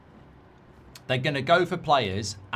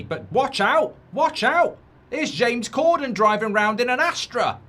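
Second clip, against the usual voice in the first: high, loud hammed-up commentator; mock race commentary; 7 semitones higher, 3 dB louder than usual. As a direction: call the race like a breathless announcer.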